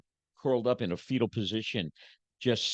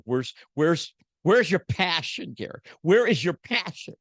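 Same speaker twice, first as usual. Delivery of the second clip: wife's pleading demand; 6 semitones higher, 4 dB louder than usual. Retold or imitated. Wife confronting him, retold.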